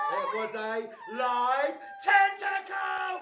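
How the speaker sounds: slow, high, loud build to a shout; dramatic shouted reveal. A narrator's reading. Storyteller revealing the monster to children, dramatic shout. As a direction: draw it out, then yell the scare.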